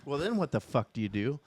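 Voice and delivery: usual speaking voice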